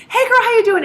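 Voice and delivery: high, loud, gushing hello to a friend; bubbly casual greeting. Retold or imitated. To a close friend, a high loud gushing greeting. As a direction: squeal it like chatting with a girlfriend. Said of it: "you would normally just say".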